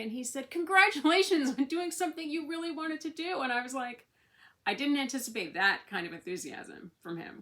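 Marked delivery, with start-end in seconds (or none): 0.5-3.4 higher, louder beaming congratulations, imitating doctor; enthusiastic praise. Doctor congratulating patient on her tattoo, high loud enthusiastic praise. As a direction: cheer her on with real delight.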